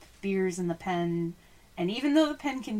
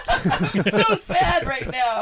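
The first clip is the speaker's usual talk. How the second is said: laughing through the words, slow and louder; 6 semitones higher, 8 dB louder, slower than usual. Real, not acted. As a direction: giggle out a mock lament. Laughing over January sadness.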